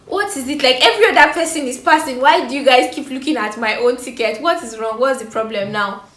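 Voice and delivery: exasperated. Retold or imitated. Exasperated at check-in counter.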